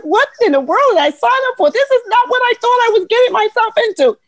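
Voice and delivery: high, rushed mock panic; disbelief. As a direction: squeal it in overwhelmed disbelief. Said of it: "it was like".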